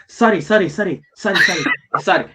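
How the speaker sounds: hammed-up apologetic fluster, half laughing; mock panicked apology. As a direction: imitate a man frantically backpedaling. Voices Korean guy apologizing, caught out.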